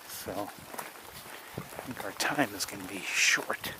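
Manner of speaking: a quick hushed aside; whisper. Real, not acted. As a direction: drop to a voiceless whisper. Hushed aside while filming outdoors.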